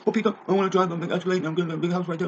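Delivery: high, sneering whiny voice; mock them in a high put-on whine; mocking whiny kid. Ranter mocks kids in a high whiny voice. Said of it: "they're like".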